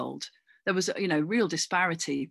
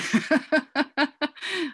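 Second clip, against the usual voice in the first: high, bright burst of laughter; real laugh breaking out; 10 semitones higher, 4 dB louder than usual. Real, not acted. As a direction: laugh out at the memory. Laughs at great gran memory.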